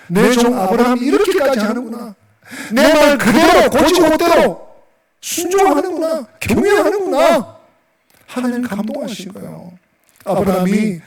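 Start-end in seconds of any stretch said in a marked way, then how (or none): 0.1-7.6 deeply moved God. Acted. God moved by Abraham's obedience, high, marveling voice. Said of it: "하나님이 감동을 먹으시는".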